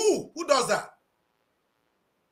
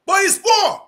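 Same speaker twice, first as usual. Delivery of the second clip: shouted high and louder; emphatic outburst; 8 semitones higher, 7 dB louder, slower than usual. Real, not acted. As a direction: burst out with it. Defending the governor, outburst.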